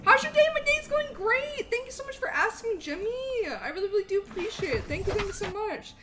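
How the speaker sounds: high-pitched excited streamer greeting; gushing thanks. Real, not acted. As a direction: burst in loud and high, then settle slightly. Streamer gushes thanks to a viewer.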